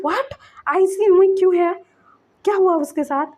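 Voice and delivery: retold shocked mother; shocked alarm. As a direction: react as if the news hits hard. Mother hears child in ICU.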